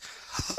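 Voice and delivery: sharp startled intake of breath; shocked gasp. Retold or imitated. Hotel clerk hearing where the guest went, gasping. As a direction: gasp in horror at the news. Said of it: "he goes".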